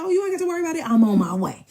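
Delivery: high, loud comeback; shouted retort. Retold or imitated. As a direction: yell it back at him. Acted quarrel, shouting back.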